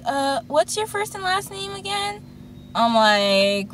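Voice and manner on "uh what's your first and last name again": imitating the interviewer. Mimicking the interviewer.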